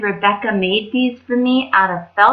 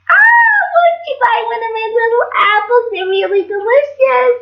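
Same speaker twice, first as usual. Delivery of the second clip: put-on old lady voice; 13 semitones higher, 4 dB louder than usual. A narrator's reading. Disguised queen as old lady.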